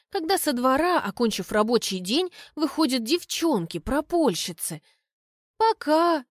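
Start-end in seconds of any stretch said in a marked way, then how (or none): none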